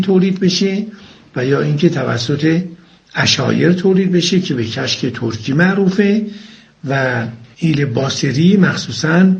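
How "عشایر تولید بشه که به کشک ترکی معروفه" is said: ordinary delivery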